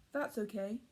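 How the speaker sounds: plain reading voice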